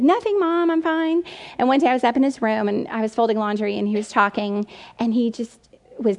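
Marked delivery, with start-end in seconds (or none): 0.0-1.2 high-pitched mock brush-off; moody son. Moody son to his mom, high and dismissive. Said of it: "just being moody and difficult and snapping at me".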